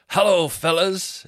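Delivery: loud, high, slow showman greeting; imitation of a comic working the crowd; Dino Bean. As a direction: draw out a cocky hello to the room. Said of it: "Dino Bean tries to start".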